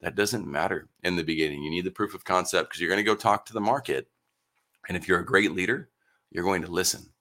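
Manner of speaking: ordinary talk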